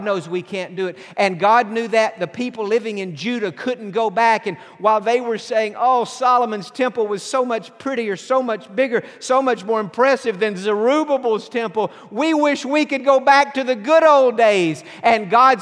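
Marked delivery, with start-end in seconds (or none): 5.8-14.8 mock longing lament. People longing for the past.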